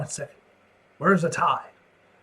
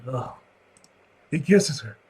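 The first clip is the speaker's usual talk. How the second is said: ordinary delivery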